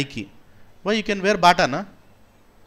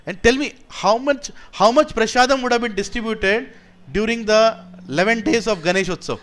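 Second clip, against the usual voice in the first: preaching at full force; 6 semitones higher, 5 dB louder than usual. Acted. Preacher challenging the crowd about feeding the hungry, forceful preaching. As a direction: press the crowd with each question.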